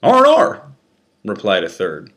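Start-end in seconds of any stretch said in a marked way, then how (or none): 0.0-0.6 much higher, louder guard voice; guard's reply. Acted. Third officer lies to the prisoner, high mocking reply. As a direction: toss it off like a sneering guard.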